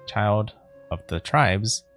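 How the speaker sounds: ordinary talk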